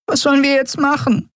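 very high-pitched helpless question; small creature at a loss, squeaky; desperate fairy. A narrator's reading. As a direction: plead in a tiny, panicky high voice. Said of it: "fragte sie verzweifelt".

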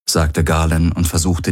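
usual speaking voice

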